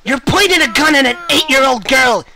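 loud, indignant shouting; scolding yell at a gunman; outraged rebuke. Pretend.

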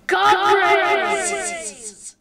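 high, loud, drawn-out gushing; enthusiastic. Acted. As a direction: exclaim it like a thrilled fan. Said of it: "read out the first one really enthusiastically".

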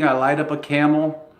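ordinary delivery

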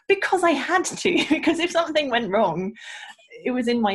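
high, loud exasperated answer with a laugh; emphatic mock protest. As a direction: re-enact stating the obvious, laughing. Retold answer to people's question.

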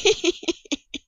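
a high, gleeful giggle; gloating little laugh. Acted. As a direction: snicker at his mess. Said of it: "fragt sie lachend".

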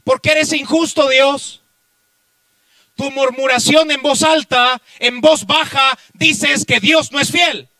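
loud, high pulpit cry; impassioned preacher at full volume; full-force preaching. Real, not acted. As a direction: hurl it at the congregation as an accusation.

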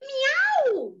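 high playful "Miau"; cat meow. Acted. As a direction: meow brightly like a cartoon cat. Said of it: "el gatito le dijo".

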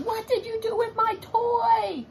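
a bossy little sister interrogating; demanding child. Acted. Olivia accusing her brother Ian, high and demanding. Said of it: "She's not being very nice".

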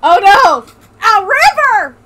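mock alarm. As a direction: cry out in fake dismay at the river. Game event, mock alarm.

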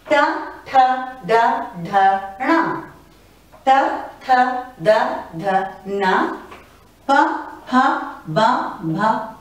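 each row read clearly, second sound of every pair breathy; teacher reading letters aloud one by one; careful recital of the alphabet; reciting consonants. Shown as a demonstration. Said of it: "let me read out the entire Vyanjan to you".

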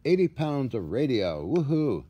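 ordinary talk, nothing marked

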